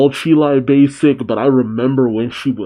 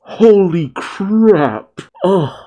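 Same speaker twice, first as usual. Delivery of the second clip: high, drawn-out amazement; slow, high-pitched marvelling; awed exclamation; 7 semitones higher, much slower than usual. Real, not acted. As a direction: stretch both words out in awe. Drooling over pictured food.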